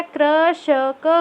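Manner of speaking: कृषक drawn out slowly, each syllable apart. Shown as a demonstration. Teacher sounds out कृषक slowly for pupils. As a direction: stretch कृषक slowly to spell it out. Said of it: "कृषक हो गया है".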